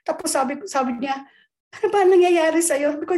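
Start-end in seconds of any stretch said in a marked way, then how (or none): 1.7-3.0 higher, louder worried aunt demanding an answer; the aunt raising her voice at a frightened niece; alarmed aunt. Retold or imitated. (nag-aalalang tiyang pasigaw na nagtatanong)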